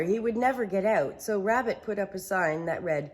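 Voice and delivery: plain reading voice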